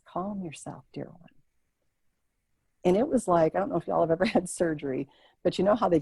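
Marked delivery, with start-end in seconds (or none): 0.0-1.3 much softer, gentle calming; soothing grandmother. Retold or imitated. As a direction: soothe a frightened child. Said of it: "And she says".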